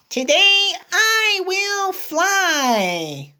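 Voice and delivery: high, slow little pig voice; dreamy pig announcing a plan; Piggy voice. Acted. Parent reading Elephant and Piggy, as Piggy: high and slow.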